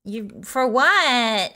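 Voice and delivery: put-on stretched-out Valley Girl protest. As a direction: drag the last word like a Valley Girl. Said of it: "Valley Girl accent".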